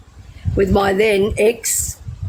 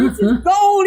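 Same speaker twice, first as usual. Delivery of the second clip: laughing praise; 13 semitones higher, slower than usual. Retold or imitated. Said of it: "he said".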